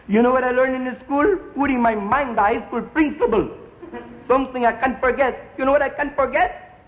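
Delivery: put-on high, earnest pep talk in a thick Spanish accent; rally the gang in a heavy Spanish accent. Gang leader rallying the guys, thick Spanish accent. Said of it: "his Spanish accent is kinda dope".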